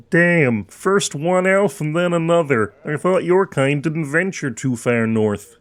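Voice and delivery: put-on small-town local voice; wary townsman. Local remarking on elves coming north, wary character voice. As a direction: townsman grumbling about outsiders.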